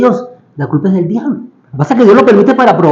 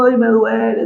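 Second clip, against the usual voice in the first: mock moaning complaint; 3 semitones higher, 5 dB quieter than usual. Acting out what the prisoners did not say, mock whine. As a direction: moan as if hurting, hammed up. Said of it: "No se estaban quejando".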